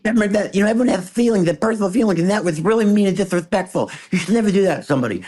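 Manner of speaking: imitating the champ telling him off about the jokes; Mike Tyson voice. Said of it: "he started screaming at me like".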